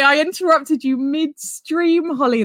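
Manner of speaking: ordinary talk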